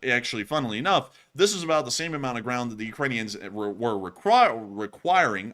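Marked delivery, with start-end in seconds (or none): 3.5-5.5 tripping over the word and restarting; halting false starts; stuttering. Real, not acted. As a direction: stumble on the word, then get it out. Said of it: "I don't know why I'm stuttering so much".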